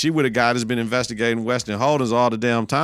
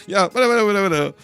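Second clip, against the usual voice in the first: mock dismissive Mary; 8 semitones higher, much faster than usual. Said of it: "Mary be like".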